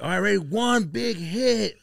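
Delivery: high, slow build-up cue; egging-on friend. Retold or imitated. Friends cue his big hit, drawn-out high voice. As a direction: imitate a stoner buddy cueing him.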